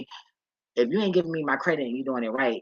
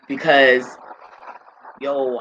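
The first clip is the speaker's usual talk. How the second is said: normal talking voice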